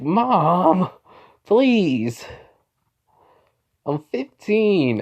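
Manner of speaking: put-on high, drawn-out teenage whine; whiny protest. Son objects to mom calling him baby boy, whining. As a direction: act out an embarrassed son's plea.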